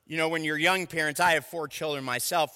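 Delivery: normal talking voice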